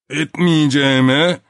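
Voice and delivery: slow, loud stretched plea; drawn-out forced plea. A narrator's reading. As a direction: drag the word out desperately. (uzatılmış zoraki yalvarış)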